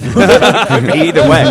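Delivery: slow words through loud laughter; laughing. Real, not acted. Radio host cracking up at guest's joke, laughing loudly. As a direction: laugh it out as you speak.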